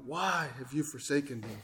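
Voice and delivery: subdued retold cry, low and soft; quiet, low lament; Jesus. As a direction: imitate an anguished cry in a hushed, low voice. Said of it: "he cried out".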